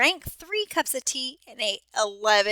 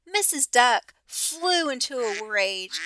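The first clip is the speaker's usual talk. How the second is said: ordinary delivery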